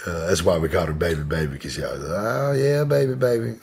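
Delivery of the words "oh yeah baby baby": higher, drawn imitation of the aunt; aunt's catchphrase. Nephew showing how his aunt always talked, higher. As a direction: do her pet phrase, pitched up. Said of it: "Like, that's how she talks".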